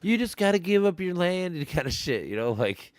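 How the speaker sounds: high sneering imitation of Trump; mocking Trump. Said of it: "he's like".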